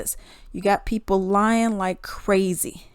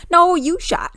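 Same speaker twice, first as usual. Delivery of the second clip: emphatic rebuttal; 9 semitones higher, 6 dB louder, slower than usual. Real, not acted. Rebutting the cop.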